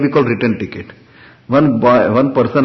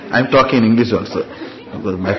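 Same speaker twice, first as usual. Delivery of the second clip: laughing; 2 semitones lower, 4 dB quieter, much faster than usual. Real, not acted. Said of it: "laughing after joke".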